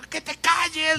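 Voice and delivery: high, insistent hushing command; imitated angry husband. Retold husband silencing wife while hitting. (imitando al marido exasperado)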